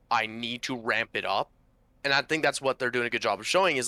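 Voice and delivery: ordinary talk, nothing marked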